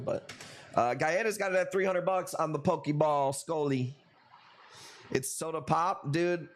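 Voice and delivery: normal talking voice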